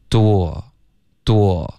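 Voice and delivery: DOOR drawn out as "doo-wo", without the r; opening glide. Shown as a demonstration. Teacher building the word in steps, slow glide demo. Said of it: "有一个喔的音".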